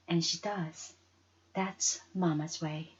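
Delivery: usual speaking voice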